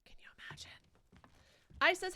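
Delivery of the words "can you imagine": very soft, voiceless aside; hushed repeat under the breath; whispered. Real, not acted. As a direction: whisper it to yourself. Wistful whispered repeat to self.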